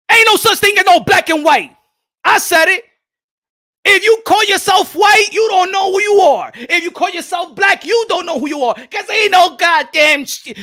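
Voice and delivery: loud, high, fierce rant; preaching-loud outrage; heated shouting. Real, not acted. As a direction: shout it at the room with full force. Heated rant on race labels.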